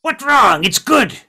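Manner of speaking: high, loud goblin outburst; indignant goblin. Acted. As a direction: protest loudly as an offended goblin. Game master voices indignant goblin.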